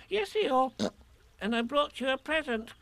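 high, thin small-animal voice; Piglet. A narrator's reading. Piglet tells Eeyore of his present, high and piping. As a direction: pipe the news up high.

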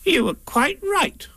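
very high, slow creature voice; Rabbit. A narrator's reading. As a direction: concede it slowly in a high voice. Rabbit looks Pooh over.

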